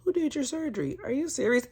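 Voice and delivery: high, gushing mock surprise; mock shock. Vlogger imitating the doctor's gushing, high mock surprise. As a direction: gasp it out, pretend amazement. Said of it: "I wouldn't have been like".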